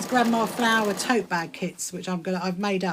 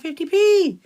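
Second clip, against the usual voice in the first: high, loud celebration; excited shout rising into a woohoo; gleeful cheer; 10 semitones higher, 10 dB louder than usual. Real, not acted. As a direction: whoop with delight at a small find. Celebrates finding money.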